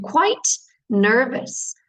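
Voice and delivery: QUITE NERVOUS said slowly, each word separate; slow, careful "quite nervous"; careful pronunciation model. Shown as a demonstration. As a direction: stretch out "quite nervous" for learners to copy.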